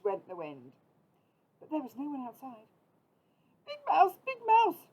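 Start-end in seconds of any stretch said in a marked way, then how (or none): 3.7-4.9 high, loud little mouse call. A narrator's reading. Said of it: "called Little Mouse".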